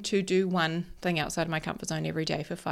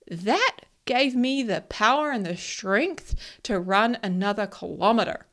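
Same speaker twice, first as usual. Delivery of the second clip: normal talking voice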